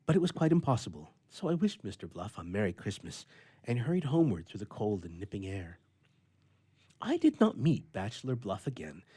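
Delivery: usual speaking voice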